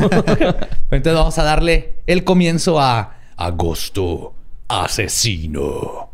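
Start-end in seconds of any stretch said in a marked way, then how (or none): 3.4-6.1 dramatic title drop. Acted.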